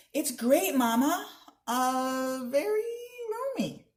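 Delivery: put-on high strained cheerful boy; boy faking delight to please his mother; forced enthusiasm. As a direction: fake it's great with rising pitch. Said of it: "Ella...made an exaggerated kissing face".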